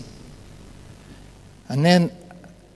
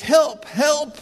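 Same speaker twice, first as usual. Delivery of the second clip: cry for help; 8 semitones higher than usual. Retold or imitated. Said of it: "He goes".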